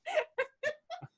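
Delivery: bright burst of high laughter. Real, not acted. Laughing at her cohost's joke, a sudden high laugh. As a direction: let a real laugh spill out high.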